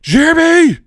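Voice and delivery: screamed name at a pupil, high and loud; furious yell. Retold or imitated. Substitute teacher yelling at a pupil, furious scream. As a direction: bellow the name like a furious drill sergeant. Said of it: "yelled at them".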